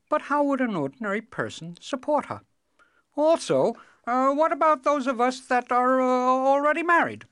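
high, loud, hesitant objections; haggling cobbler. Acted. Cobbler bargains with the king over reward, objecting.

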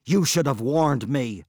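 loud, outraged outburst; burst out. Acted. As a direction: blurt it in anger. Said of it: "burst out Mabon".

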